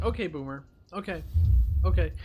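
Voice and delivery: breezy, dismissive okays; shrugging off an angry arguer, dismissive; passive-aggressive agreeing. Retold or imitated. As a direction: brush them off with a shrug in the voice. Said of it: "and you're just like".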